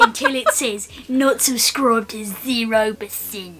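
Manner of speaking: put-on Australian accent. Partner joking over the sign-off, Australian accent. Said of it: "In your Australian voice".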